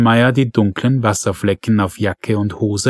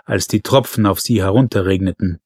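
ordinary delivery

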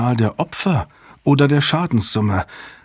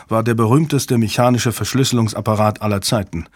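ordinary delivery, nothing marked

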